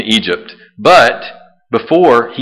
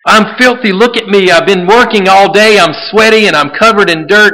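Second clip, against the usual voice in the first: anxious guest; 7 semitones higher, 4 dB louder than usual. Retold or imitated. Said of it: "would have protested".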